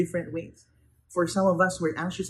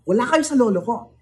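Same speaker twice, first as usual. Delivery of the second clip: louder, higher schoolyard taunt; playground brag in Filipino; 4 semitones higher, 6 dB louder, faster than usual. Retold or imitated. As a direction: taunt them like a kid bragging about his grandpa. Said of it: "Think about that in the tone of".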